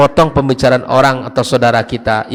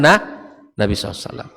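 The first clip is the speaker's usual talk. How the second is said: ordinary delivery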